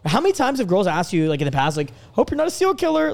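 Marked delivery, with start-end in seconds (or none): 2.1-3.1 high, teasing mock-nervous girl; half-joking nervous girl voice, pitched up. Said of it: "like, in a joking way?".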